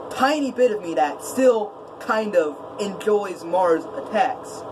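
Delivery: ordinary delivery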